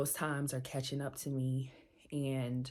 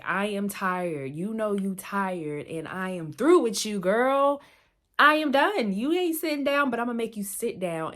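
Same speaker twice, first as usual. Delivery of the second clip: higher, much louder, fed-up sassy scolding; body's exasperated voice; 6 semitones higher, 10 dB louder than usual. Acted. Her worn-out body scolding her for never resting, loud fed-up sass. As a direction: play your own body snapping that it's done with you, girl. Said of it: "your body is like".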